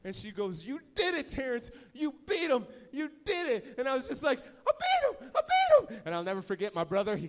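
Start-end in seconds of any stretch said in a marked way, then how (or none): 0.6-3.8 high, excited congratulations; raised-pitch motherly delight; proud mom cheering. Retold or imitated. Mom celebrates the win. 4.4-6.0 very high, loud mock-tearful squeal; hammed-up high-pitched celebration; overjoyed. Child celebrates beating brother.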